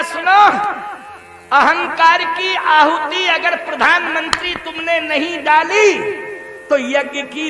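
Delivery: commanding voice of God, last words loud and stretched; stern divine decree. Acted. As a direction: lay down the law, drawing out the final words. God sternly warns the prime minister.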